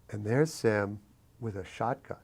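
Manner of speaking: usual speaking voice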